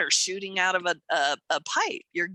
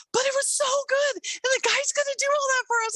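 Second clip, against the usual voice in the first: very high-pitched excited imitation; gushing with delight. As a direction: mimic her suddenly thrilled by good news. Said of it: "she just shifted".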